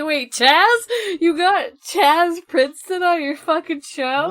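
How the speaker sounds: stunned delighted yell at the news; excited disbelief. Real, not acted. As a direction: blurt it out in amazed disbelief. Real shock at a guest's name.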